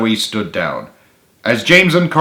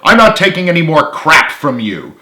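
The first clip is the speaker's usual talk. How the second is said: angry shouting; 6 semitones higher, 5 dB louder than usual. A narrator's reading. Ongoing shouting match.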